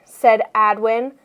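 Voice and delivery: ordinary delivery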